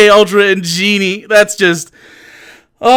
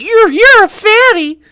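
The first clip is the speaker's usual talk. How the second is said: mocking the troll; 14 semitones higher than usual. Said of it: "he's like".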